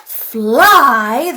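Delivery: loud, puzzled troll roar. Acted. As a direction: bellow it like a confused troll. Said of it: "the trolls roared".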